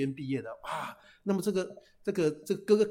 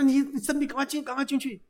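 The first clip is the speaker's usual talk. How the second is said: startled king; 9 semitones higher than usual. Acted. King startled to see princess.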